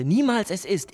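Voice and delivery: Niemals hit high and loud; stress on Niemals. Acted. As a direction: punch Niemals, then drop. Ausbruch gegen das ewige Könnte. (Betonung auf „Niemals“, laut und hoch)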